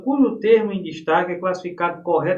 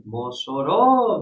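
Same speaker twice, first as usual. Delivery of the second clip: "Mossoró" with the final RÓ stretched long; slow "Mosso-RÓÓÓ", teacher's demo; stress on RÓ; 4 dB louder, much slower than usual. Shown as a demonstration. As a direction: draw out the last syllable of "Mossoró". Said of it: "a sílaba tônica é o ró".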